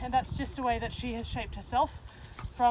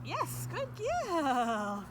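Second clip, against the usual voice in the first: praise; 6 semitones higher, much slower than usual. Real, not acted. Praising dog after trick.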